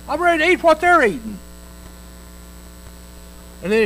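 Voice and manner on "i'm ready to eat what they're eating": desperate son. Acted. Hungry son among the pigs.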